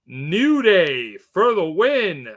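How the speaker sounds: slow, swooping hype announcer; building drawn-out pick reveal; announcer read. Acted. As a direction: stretch every word like a ring announcer. Hyped announcing of everyone's picks.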